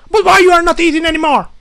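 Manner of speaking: high, loud mocking yell at a housemate; imitated screaming roommate. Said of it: "screams and he says".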